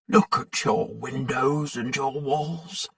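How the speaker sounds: put-on an old man speaking softly to his house; quietly. A narrator's reading. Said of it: "he said quietly".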